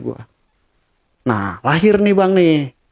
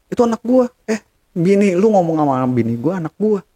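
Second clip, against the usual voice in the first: high, raised, insistent; heated claim; 6 semitones higher than usual. Retold or imitated. Husband yelling over the phone, high and heated. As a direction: insist hard, voice pitched way up.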